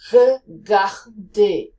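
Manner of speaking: "regardez" with a French throaty R, slow. Shown as a demonstration. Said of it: "Now say regardez".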